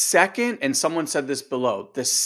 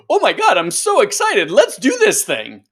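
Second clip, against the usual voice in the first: much higher, louder, gushing excitement; excited mind voice; 7 semitones higher, 8 dB louder than usual. Retold or imitated. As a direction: squeal it like a thrilled inner voice. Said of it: "your mind's like".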